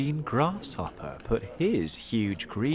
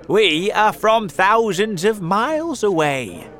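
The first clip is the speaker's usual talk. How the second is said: loud, high call to people below; big raised voice, high and carrying; shouting centipede; 9 semitones higher, 9 dB louder than usual. A narrator's reading. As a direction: shout it proudly across the distance. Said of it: "the centipede shouted".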